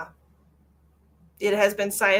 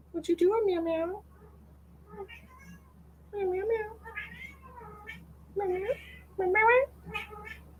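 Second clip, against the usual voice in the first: high cooing to the kitty; 13 semitones higher, 8 dB quieter than usual. Real, not acted. Baby talk to her cat.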